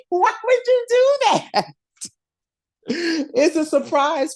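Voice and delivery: high-pitched, laughing disbelief; mock exasperation. Fan scolding Steve for spoiling the surprise, mock exasperation. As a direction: scold him in an incredulous squeal.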